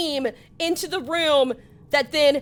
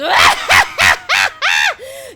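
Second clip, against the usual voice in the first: loud, high shrieking cackle; evil skull cackle; 12 semitones higher, 10 dB louder than usual. Acted. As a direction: cackle like a gleeful flaming skull. Flame skull reveals itself.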